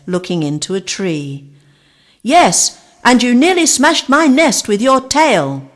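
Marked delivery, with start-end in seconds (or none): 2.2-5.7 annoyed bird. Acted. Bird complains about nest.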